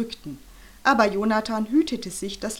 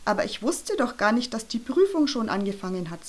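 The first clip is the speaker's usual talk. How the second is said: usual speaking voice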